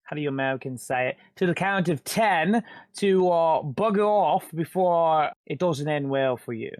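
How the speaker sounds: put-on lightly done British accent, cocky threat; British character. Xander threatening the thug, mild British accent.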